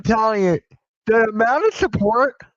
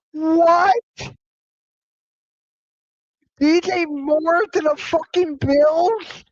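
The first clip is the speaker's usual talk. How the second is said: higher, stunned at the trade news; real shock reading D J Moore to the Bills; 8 semitones higher, slower than usual. Real, not acted. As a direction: disbelief rising, slowed down.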